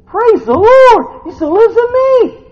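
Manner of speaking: high, loud, drawn-out shout; exultant praise. Real, not acted. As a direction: shout praise at the top of your range.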